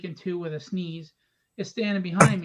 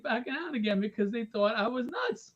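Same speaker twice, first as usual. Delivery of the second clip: usual speaking voice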